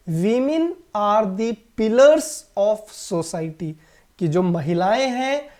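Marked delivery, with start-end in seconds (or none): 0.1-3.7 very slow, careful dictation pace; slow model sentence. Shown as a demonstration. Teacher reads the example sentence to the class very slowly. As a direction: spell it out word by word. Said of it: "और यहाँ पे sentence".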